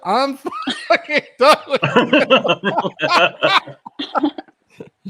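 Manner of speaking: high and loud through laughter; laughing. Real, not acted. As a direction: crack up mid-sentence. Cracking up at friend.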